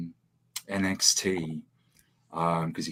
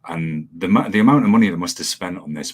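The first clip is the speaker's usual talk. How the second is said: ordinary talk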